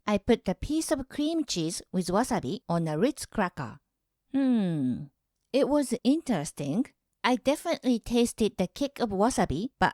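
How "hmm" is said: low, considering hum while tasting; a low musing hmm; thoughtful hmm. Real, not acted. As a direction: hum it over like you're judging the taste. Tasting cheese with wasabi.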